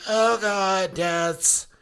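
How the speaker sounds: high-pitched, hammed-up alarm; mock dread. Grown son watching Dad get mad, mock panic. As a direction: imitate a grown son dreading Dad's temper. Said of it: "all of a sudden he's leaning to the right like".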